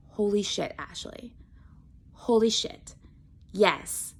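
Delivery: fading into a soft whisper; a hushed self-realization. Retold or imitated. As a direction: breathe it out in disbelief. Realization, talking to herself.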